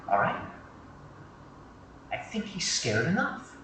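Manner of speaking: hushed, halting private plea; whispering. Acted. Rookie pleading with his partner to spare a kid, whispering. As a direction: keep it very soft, just for one ear. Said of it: "I whisper in his ear".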